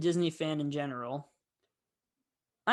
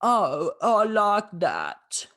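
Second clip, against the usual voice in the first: slow, louder, savouring "oh, I like that"; imitating Cruella pleased with a name, slow and louder; Emma Stone's delighted Cruella; 4 semitones higher, 6 dB louder, much slower than usual. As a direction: purr it out like a villain taking a new name. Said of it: "Emma Stone turns and is like".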